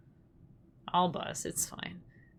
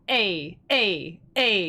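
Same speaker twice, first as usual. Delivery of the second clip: hard vowel onset; 4 dB louder, much slower than usual. Shown as a demonstration. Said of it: "If you go".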